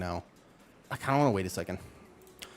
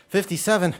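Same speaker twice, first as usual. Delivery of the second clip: loud; 9 semitones higher, 6 dB louder than usual. Real, not acted. Counting reps aloud, loud.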